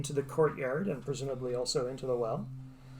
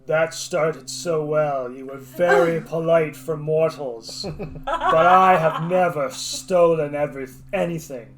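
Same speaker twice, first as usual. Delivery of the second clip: booming voice; 10 dB louder than usual. Acted. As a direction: boom it out like a spirit from the well. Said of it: "the voice echoes".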